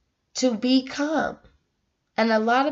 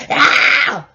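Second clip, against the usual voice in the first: frustrated cry; 3 semitones higher, 8 dB louder than usual. Real, not acted. Reacting to being questioned.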